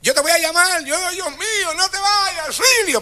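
high, hammed-up wailing farewell; mock tearful pleading. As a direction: imitate a sobbing relative begging and calling for help. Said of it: "salen llorando y me ... llorando".

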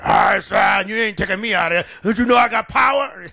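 defiant, roaring demon boast; roared up demon. Retold or imitated. Demon defies pastor, roaring. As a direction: taunt the preacher. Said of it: "That thing got roared up".

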